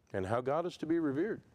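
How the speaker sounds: ordinary talk, nothing marked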